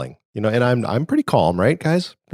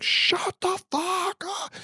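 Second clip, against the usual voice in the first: much higher, slow strained angry yell; mock furious yell; 7 dB quieter, much slower than usual. Dad yelling at the bellowing cat, high drawn-out mock yell. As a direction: snap at the cat, pitched way up and drawn out. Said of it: "Dad's gonna be like".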